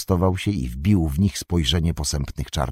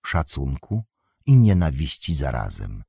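ordinary delivery, nothing marked

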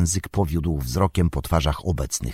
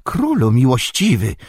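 higher, louder alarmed cry; cried out; 6 semitones higher, 4 dB louder than usual. A narrator's reading. Wormtongue crying out to the king in alarm. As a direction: protest in alarm. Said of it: "krzyknął gadzi język".

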